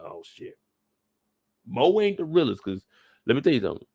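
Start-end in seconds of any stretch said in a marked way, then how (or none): 0.0-0.6 whispered shock. Retold or imitated. Reacting while reading.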